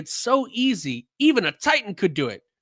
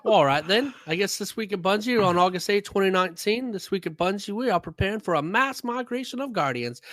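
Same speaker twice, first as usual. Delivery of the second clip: mock British accent drifting into a Southern twang; British turned Southern. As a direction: start posh British, slide into Southern. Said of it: "I just went from British to Southern".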